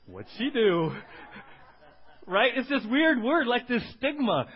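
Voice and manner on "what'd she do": louder nosy gasp of a crowd; mock gossiping crowd. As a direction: act the curious congregation. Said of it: "this group goes".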